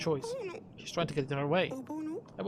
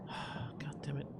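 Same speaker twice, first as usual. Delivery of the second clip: much softer whispered swear; whispered curse; 3 semitones lower, 12 dB quieter, much slower than usual. Real, not acted. Player whispering a curse after a failed choice. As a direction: breathe the curse under your breath.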